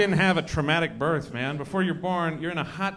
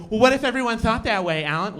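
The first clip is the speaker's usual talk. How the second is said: whiny scold; 6 semitones higher, 4 dB louder than usual. Retold or imitated.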